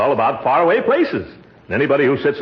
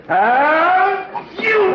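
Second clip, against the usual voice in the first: huge sneeze. Acted. Uncle sneezing so hard the windows rattle, explosive. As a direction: let the sneeze blow up out of you. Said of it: "that one made the windows rattle".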